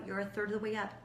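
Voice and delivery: usual speaking voice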